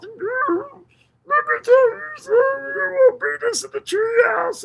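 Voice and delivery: high, slow shouted parody; mock voice. Mocking someone in the chat.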